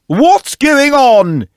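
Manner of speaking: high, loud, very slow king's shout; king bursting in shouting. Acted. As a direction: bellow in surprise. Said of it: "he shouted".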